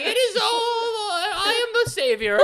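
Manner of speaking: high, slow, hammed-up proclamation; mock grand savior. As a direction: announce yourself as the hero, overplayed. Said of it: "Kate was like".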